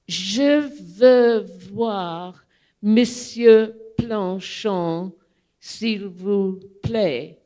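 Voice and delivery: slow, deliberate schoolbook French; slow, careful French read off a note; careful French request. Retold or imitated. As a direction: spell out each word of a rehearsed line. First careful request to doorman.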